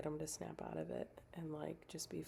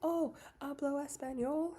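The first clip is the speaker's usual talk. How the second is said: put-on high, louder showy "hablo español" in a Spanish accent; mock Spanish; 10 semitones higher, 6 dB louder than usual. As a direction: show off your Spanish playfully, pitched up. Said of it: "And I was like".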